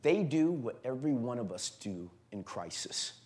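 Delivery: low, soft, grave preacher voice; hushed preaching. Acted. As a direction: drop right down and speak softly to the congregation.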